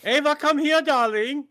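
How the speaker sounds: high, loud comic Hitler calling out; hammy dictator calling his wife; Hitler impression. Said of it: "That was my Hitler impression".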